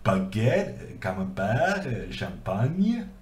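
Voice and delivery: French words with swooping, lilting French pronunciation; French accent. Shown as a demonstration. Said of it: "say some French words".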